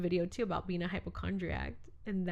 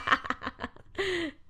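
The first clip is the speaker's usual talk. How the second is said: high-pitched peal of laughter; 9 semitones higher than usual. Real, not acted. Laughing after a teasing remark.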